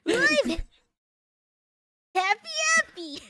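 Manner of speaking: high, loud cartoon announcement; exclaiming in character. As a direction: announce the name at full volume. Happy announces himself to the oranges.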